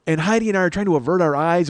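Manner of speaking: normal talking voice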